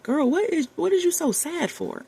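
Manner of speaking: high, incredulous self-scolding; self-talk. Retold or imitated.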